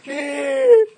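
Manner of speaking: very high squealed brake noise; screeching halt. Acted. Storyteller imitating the horse stopping at the precipice, high squeal. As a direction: imitate a skidding stop.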